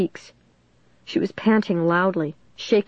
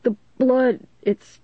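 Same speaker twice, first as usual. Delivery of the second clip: faltering, pained plea; hurt and halting; 2 semitones higher than usual. A narrator's reading. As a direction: struggle through the pain, breaking off. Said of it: "he grimaced in pain".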